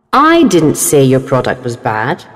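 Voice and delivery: "I" stressed, the rest lighter. Shown as a demonstration. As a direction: hit the word "I". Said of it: "sentences have changed meanings with different stress".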